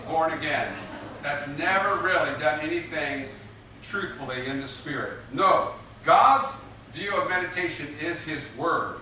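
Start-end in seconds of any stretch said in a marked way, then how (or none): none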